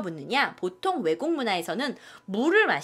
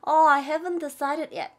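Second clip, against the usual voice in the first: higher, slow rueful "Oh"; regretful; 6 semitones higher, much slower than usual. Shown as a demonstration. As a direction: sound a bit sorry. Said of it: "아쉬운 표정". (아쉬운 표정으로)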